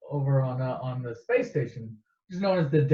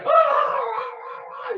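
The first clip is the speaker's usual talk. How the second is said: panicked screams; slower than usual. Retold or imitated. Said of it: "He's all freaking out".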